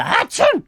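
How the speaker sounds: very high, loud mock sneeze; fake sneeze. Presenter sneezing at his visitor, fake sneeze. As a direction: burst out with an exaggerated sneeze.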